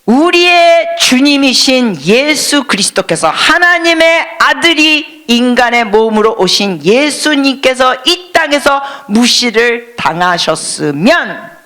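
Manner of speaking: usual speaking voice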